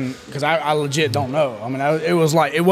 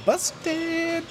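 teasing "busted"; 13 semitones higher, 9 dB quieter than usual. Real, not acted. Teasing a friend caught out.

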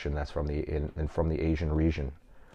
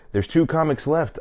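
mimicking the host, pitched higher; imitating the host; 10 semitones higher, 3 dB louder than usual. Comic retelling how the host introduced the last two comics, pitched higher. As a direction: play the host announcing the lineup, higher. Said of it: "she goes".

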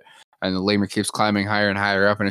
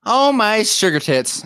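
startled outburst; 13 semitones higher, 7 dB louder, slower than usual. Real, not acted.

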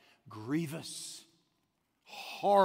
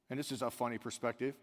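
ordinary talk, nothing marked

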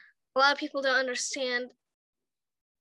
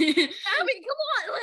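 higher, pleading "come on" to a dawdling friend; impatient urging; 7 semitones higher, much slower than usual. Retold or imitated. Girl urging her friend Abby to hurry, pleading and impatient.

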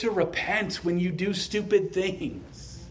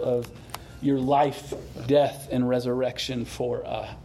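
pastor leading a gentle closing prayer; 4 semitones lower than usual. Acted.

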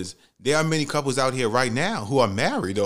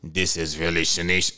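much lower mock deep voice; deep-voiced woman; 7 semitones lower than usual. Date answers his call in a mock deep voice. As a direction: imitate her surprisingly deep phone voice. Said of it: "But her voice was deep as fuck".

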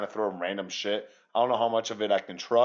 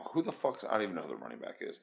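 quick, much softer muttering to himself; muttered aside; 3 semitones lower, 9 dB quieter, faster than usual. Real, not acted. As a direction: mumble it under your breath, fast. Aside, forgets running back's name.

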